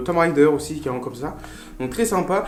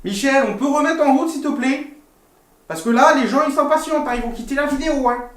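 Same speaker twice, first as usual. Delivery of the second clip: appel faussement impatient; 6 semitones higher, faster than usual. Real, not acted. Calls offscreen Michel to restart video.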